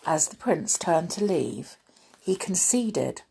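ordinary delivery

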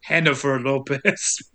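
lightly mock the Spanish pronunciation; lightly mocking Spanish accent on the name; fake Spanish accent. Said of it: "He's like".

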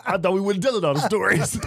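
high laughing disbelief. Real, not acted. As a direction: laugh through the words.

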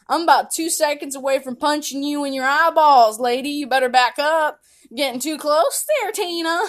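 hammed-up tough-girl threat; mock threat. Warning the lady to back off, mock threatening. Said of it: "I was like".

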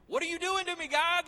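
very high, loud mock wail to God; anguished yell. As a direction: scream it upward in hammed-up despair. Acts anguished cry to God.

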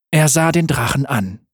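ordinary delivery, nothing marked

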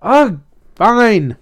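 loud, high exasperated surrender; irritated, reluctant agreement; grudging. A narrator's reading. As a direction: give in with a big huff. French fry gives in grudgingly.

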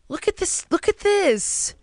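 mock wonder. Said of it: "I'm like".

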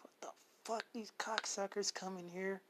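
hushed, seething fury under the breath; a worker on the way to his shift, cursing the attackers in a whisper; angry whisper. Retold or imitated. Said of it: "I was angry".

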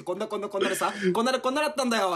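fast, rattled-off blah blah; rushed retelling. Retold or imitated. Friend rattling off a five-minute story, sped up. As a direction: gabble through the long story. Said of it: "五分ぐらい俺が話して".

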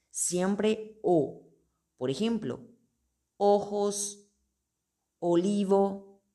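ordinary talk

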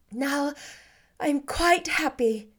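settled joy after reassurance; Jane, happy. Acted. Jane content.

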